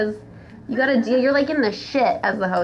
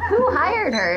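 laughing, higher and incredulous; laughing disbelief; 9 semitones higher than usual. Real, not acted. Friend laughing in disbelief at the story. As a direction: ask it through a laugh.

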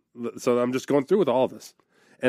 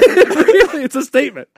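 higher and louder through laughter; laughing; 9 dB louder than usual. Real, not acted. Joking with friends about the piano, laughing. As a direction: laugh it out, mock proud.

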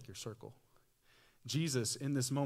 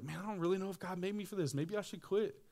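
1 discouraged self; 7 semitones higher than usual. Retold or imitated. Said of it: "You're like".

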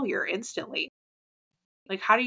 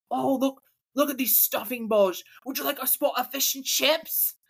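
higher, hammed-up British accent; exaggerated British foodie; mock British accent; 5 semitones higher than usual. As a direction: offer it like a caricature Brit. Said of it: "You can talk British".

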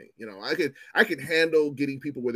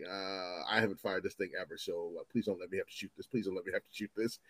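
nervous pleading; 7 semitones lower, 11 dB quieter than usual. Retold or imitated. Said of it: "And I'm like".

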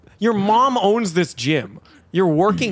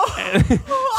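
high shrieking laugh. Real, not acted. Telling friends about the gym insult, a high helpless laugh. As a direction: burst into a high squealing laugh.

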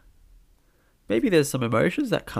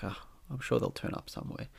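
breathy whisper, unconcerned; unbothered whisper; 3 semitones lower, 9 dB quieter than usual. Retold or imitated. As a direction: shrug it off under your breath. Shrugging off lost keys.

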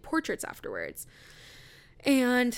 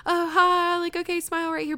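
bright, higher put-on pleasantness, louder; fake cheery photographer voice; 9 semitones higher, 7 dB louder than usual. Photographer covering for her boss with forced cheer. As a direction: paste on a cheerful voice for the family.

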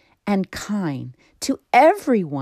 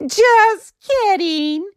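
high, slow, giggly teasing; giggling; 5 dB louder, much slower than usual. Acted. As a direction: sing it out through giggles. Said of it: "he giggled".

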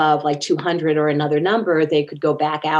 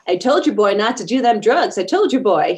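Southern mama scolding, pitched up; Southern accent; 4 semitones higher, 3 dB louder than usual. Retold or imitated. Mother to hospitalized son about drugs, scolding Southern accent. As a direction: imitate a Southern mother telling off her son. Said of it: "She had this cool Southern accent".

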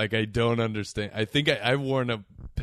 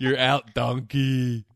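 umpire calling strikes; 4 semitones higher, 5 dB louder, much slower than usual. Pretend. Mock umpire call.

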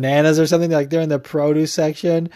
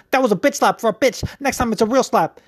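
higher, cocky imitation of the taunt; quoting Paulie; 7 semitones higher than usual. Podcaster repeats Paulie's bitch slap line in a higher cocky voice. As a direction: toss the line off like a smug trash-talker. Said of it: "this was a good line when he was like".

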